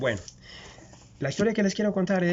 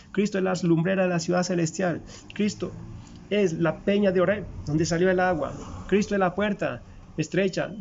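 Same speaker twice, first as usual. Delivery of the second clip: rising preaching litany; 4 semitones higher than usual. Acted. Preacher to the congregation, building fervent litany. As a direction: drive each line home like a sermon climax.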